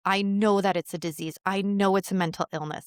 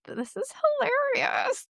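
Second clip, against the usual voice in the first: amused disbelief; slower than usual. Retold or imitated. Laughing inside at a funeral.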